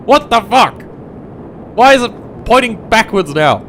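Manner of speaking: high, loud disbelieving yell; outraged shouting. Real, not acted. Gamer seeing the rocket point backwards, outraged shouting. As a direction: shout in real exasperation.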